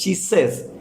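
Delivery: Gised said as the English "Jesus", "jee-zus"; English pronunciation. Shown as a demonstration. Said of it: "pero en inglés".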